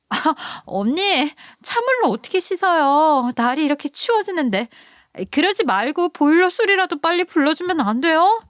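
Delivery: put-on high, pleading young woman's voice; sister-in-law calling out from the shower, high and pleading; whiny sister-in-law. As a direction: whine up high from the cold shower. Sister-in-law complains about cold water.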